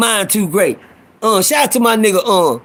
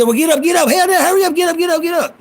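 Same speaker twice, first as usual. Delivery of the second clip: high, hurried comic Chris Tucker-like voice; Showtime impression; 8 semitones higher than usual. Storyteller mimicking Showtime shooing a newcomer off the bench, high and urgent. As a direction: pitch it way up, mock-urgent and repetitive.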